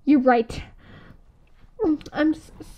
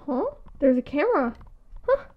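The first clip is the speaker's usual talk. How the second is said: usual speaking voice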